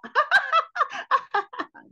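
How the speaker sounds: high, loud forced laugh; fake laughter. Shown as a demonstration. Comedian showing what gut laughter is not, fake laugh. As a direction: put on a phoney, chattering laugh.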